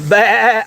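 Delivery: playful animal imitation for the goats; mock goat bleat. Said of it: "that's the wrong animal".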